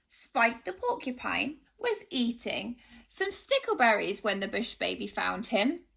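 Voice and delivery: usual speaking voice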